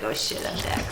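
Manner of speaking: muttered mock reaction. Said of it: "He's like".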